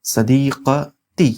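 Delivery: very slow, broken-up sadiqati; syllable by syllable. Shown as a demonstration. Teacher introducing the word for female friend, syllable by syllable. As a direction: sound out sadiqati in separate pieces.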